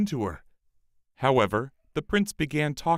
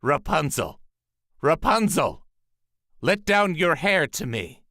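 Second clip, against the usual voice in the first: higher, louder call to the tower window; 5 semitones higher, 4 dB louder than usual. A narrator's reading. Sorceress calling up to Rapunzel in the tower. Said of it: "she stood below and called out".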